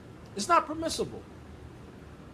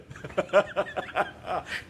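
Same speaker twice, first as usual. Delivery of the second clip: breathy run of laughter; laughing. Real, not acted. Preacher amused by a negative message ending in blessing, laughing. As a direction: laugh it out after the punchline. Laughs after translating the sign-off.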